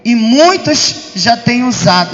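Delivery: normal talking voice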